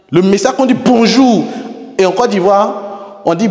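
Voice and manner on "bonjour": high, loud "Bonjour" with falling end; marked intonation. Shown as a demonstration. Teacher showing greetings differ, high falling "Bonjour". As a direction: call Bonjour high, then drop. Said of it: "c'est pas pareil".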